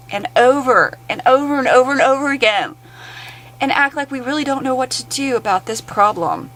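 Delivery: high, sarcastic mock of idle officials; mocking complacent officials. Ranting vlogger mocks officials who just keep meeting, high sarcastic voice. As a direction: sneer it like a smug committee member. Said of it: "sit there and twiddle our fingers and say".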